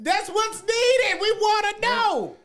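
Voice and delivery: very high, loud, drawn-out yell; high-pitched insistent shout; playful hollering. Real, not acted. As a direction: holler it slowly, stretching the words. Hollering back in playful argument.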